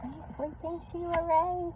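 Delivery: high, small-sister voice; little sister voice. A narrator's reading. Reader voicing the little sister, high and soft. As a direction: ask it softly like a small girl. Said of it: "her little sister asked".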